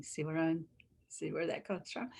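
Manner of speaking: ordinary talk, nothing marked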